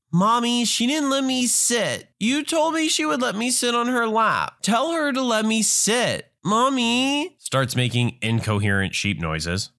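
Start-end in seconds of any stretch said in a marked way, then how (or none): 0.1-7.4 put-on whining kid. Kid tells on the traveler to mommy, high whining. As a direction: whine high like a little kid.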